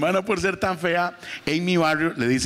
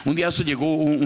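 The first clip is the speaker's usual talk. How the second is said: usual speaking voice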